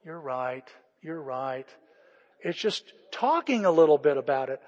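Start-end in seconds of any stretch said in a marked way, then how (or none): 0.1-1.7 lower, softer acted admission; subdued concession. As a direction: give in quietly, as if gently corrected. Acts out giving in when corrected.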